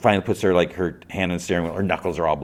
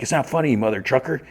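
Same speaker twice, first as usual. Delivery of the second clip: high-pitched mock annoyance; acted irritation, pitched up; indignant scolding; 8 semitones higher than usual. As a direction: tell off someone who's laughing at you. Boss scolds Guy for laughing.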